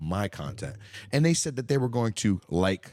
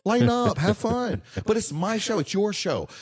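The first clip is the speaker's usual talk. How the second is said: high, urging lighten up; pleading high; 10 semitones higher, slower than usual. Real, not acted. Telling critics to lighten up, high and urging. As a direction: coax them, pitched way up.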